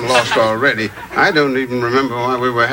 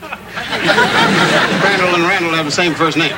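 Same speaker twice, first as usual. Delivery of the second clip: slow dawning realization; 5 semitones higher, slower than usual. Acted.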